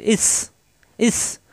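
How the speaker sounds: ইস with a hard, pressed final S; firm S. Shown as a demonstration. Teacher drilling ইস for learners, firm S. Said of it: "শক্ত হবে".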